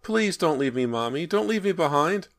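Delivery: put-on high, loud child's begging; frightened small boy's desperate plea; pleading little boy. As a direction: beg like a scared child about to be left. Trapped boy begs not to be left.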